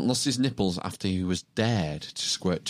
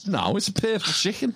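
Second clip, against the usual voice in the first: higher-pitched Scouse correction; thick Scouse accent; 9 semitones higher than usual. Acted. Correcting co-host on the emblem, thick Scouse accent. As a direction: insist on it like a Scouser. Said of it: "how thick can a Scouse accent get".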